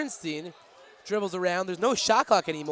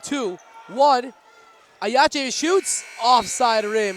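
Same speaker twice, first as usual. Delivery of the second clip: loud, higher countdown shout; buzzer countdown call; 4 semitones higher, 6 dB louder, much slower than usual. Acted. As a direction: call the buzzer shot excitedly.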